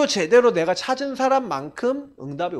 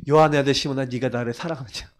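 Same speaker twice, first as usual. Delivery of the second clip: gentle questioning Jesus; 6 semitones lower than usual. Retold or imitated. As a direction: ask tenderly, not accusing.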